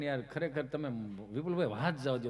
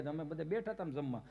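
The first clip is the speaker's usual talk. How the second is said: ordinary talk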